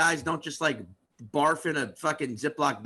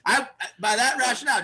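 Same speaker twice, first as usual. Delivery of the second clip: ordinary delivery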